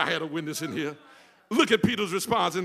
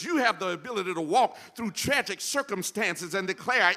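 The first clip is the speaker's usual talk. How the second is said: ordinary delivery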